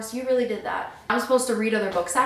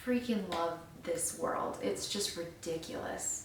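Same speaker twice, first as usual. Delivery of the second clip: ordinary talk, nothing marked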